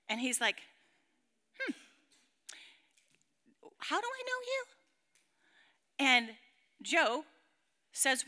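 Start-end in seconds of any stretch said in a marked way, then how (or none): 1.6-4.7 high, softer, hesitant question; puzzled. Acted. Author greeted by a stranger named Joe, puzzled. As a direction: ask softly in a high, baffled voice.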